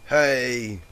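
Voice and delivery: high, loud "Hey!"; loud drunk greeting. A narrator's reading. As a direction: call out loudly, tipsy. Drunk mare greets loudly.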